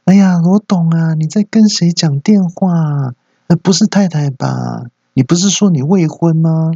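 high-pitched coquettish hostess. Acted. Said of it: "酒店小姐在说".

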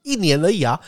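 mock salesman insisting. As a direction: mimic the salesman's pushy reassurance. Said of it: "他说".

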